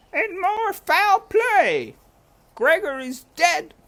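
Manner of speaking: very high, loud, slow wail of a distraught cook; high loud cook's lament; shouting cook. A narrator's reading. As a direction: cry it out like a frantic old cook. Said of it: "shouted Cook".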